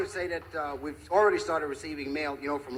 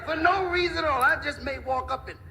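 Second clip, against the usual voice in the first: high-pitched blustering little tough guy; mock threat; 8 semitones higher than usual. Little fellow threatening to smack the big guy, blustering. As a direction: punch out the threat in bursts, much higher.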